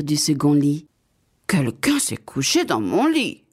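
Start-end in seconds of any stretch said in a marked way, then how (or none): 1.5-3.4 lightly voiced middle bear; a middling bear voice, neither big nor tiny; middle bear's medium voice. A narrator's reading. Middle bear finds the bed slept in, in a medium voice. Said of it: "de sa voix moyenne".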